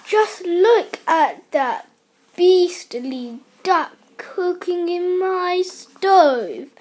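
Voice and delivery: high, loud, slow outrage; indignant cry. A narrator's reading. Missus Gregg seeing a duck at her stove, indignant cry. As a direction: cry it out, leaning indignantly on each word. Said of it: "cried Missus Gregg".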